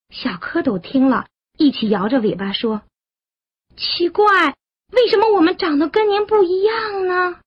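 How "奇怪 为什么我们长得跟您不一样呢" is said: put-on high, childlike wondering; puzzled tadpoles. Tadpoles questioning their frog mother, high and puzzled. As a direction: ask in a puzzled little voice, pitched well up.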